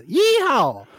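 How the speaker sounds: a big rodeo holler of "Yeehaw!"; Fort Worth yeehaw. Shown as a demonstration. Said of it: "they say yeehaw like this".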